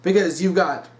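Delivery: ordinary talk, nothing marked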